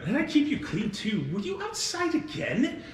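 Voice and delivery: high, fussing mock scold; a fond hostess fussing over dirty feet; scolding innkeeper. Lydia fusses at the girl about her dirty feet, high and scolding. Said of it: "And you hear Lydia go".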